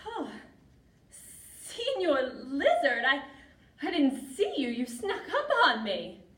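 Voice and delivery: startled cry, high and loud; high, loud, theatrical surprise. Acted. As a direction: act out being crept up on, voice leaping up. Startled Martina in the story.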